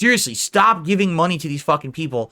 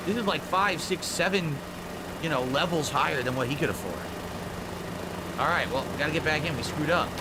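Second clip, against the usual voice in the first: ordinary talk, nothing marked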